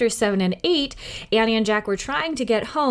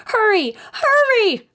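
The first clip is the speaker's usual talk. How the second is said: put-on high, louder frantic girl's scream; Annie screaming; 6 dB louder than usual. A narrator's reading. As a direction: shriek at him to hurry. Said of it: "screamed Annie".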